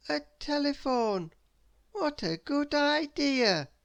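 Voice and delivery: high, slow, thrilled exclamation; light up over the gift; delighted. Acted. Mister Worry opening his present, delighted. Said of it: "said a delighted Mister Worry".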